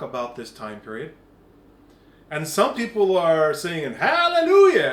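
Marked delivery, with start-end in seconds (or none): 3.9-4.9 loud, high hallelujah cry; exultant hallelujah. Retold or imitated. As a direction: rejoice out loud. Some people rejoicing.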